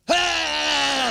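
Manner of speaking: high-pitched hollered "ah"; mock shout. Trucker answering what he stands for, high mock shout. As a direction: imitate a blaring trucker's holler.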